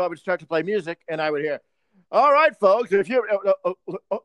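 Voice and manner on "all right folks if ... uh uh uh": louder, higher arena announcer voice; mock announcer. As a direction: boom it out like the rink announcer.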